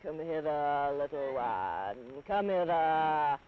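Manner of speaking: low, slow, sly crocodile croon. Acted. Crocodile luring the elephant's child, low sly voice.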